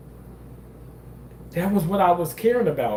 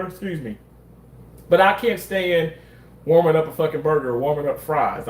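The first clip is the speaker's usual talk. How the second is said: usual speaking voice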